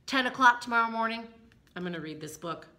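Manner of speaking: plain reading voice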